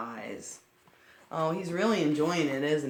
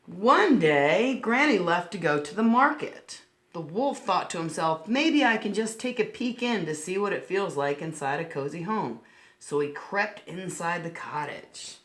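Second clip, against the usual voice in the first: storybook narration; 3 semitones higher, 5 dB louder than usual. A narrator's reading. Reading a picture book aloud to little listeners, bright storybook voice. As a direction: read it like a picture book for children.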